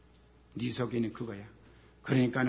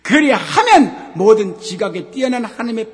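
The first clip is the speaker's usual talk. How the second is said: raised pulpit voice; fervent preaching; 9 semitones higher, 13 dB louder than usual. Acted. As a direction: press the promise hard on the listeners. Preacher building on the verse.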